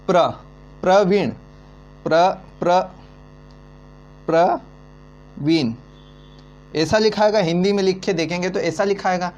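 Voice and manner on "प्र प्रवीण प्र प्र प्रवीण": Praveen drawn out slowly as प्र-वीण; slow syllable split. Shown as a demonstration. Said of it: "Praveen का वर्ण विच्छेद करते हैं". (प्रवीण धीरे-धीरे खींचकर)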